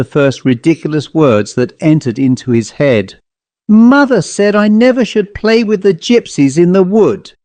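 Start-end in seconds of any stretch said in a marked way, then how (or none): 3.7-7.3 high, panicked yell in the dark wood; frantic, high-pitched singsong scream; hysterical scream. Acted. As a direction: shriek it to drown out the laughter. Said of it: "he screamed hysterically".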